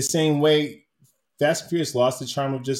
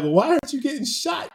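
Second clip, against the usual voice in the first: much higher, mock disbelief at the screen; incredulous question; 12 semitones higher than usual. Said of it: "Now I'm like".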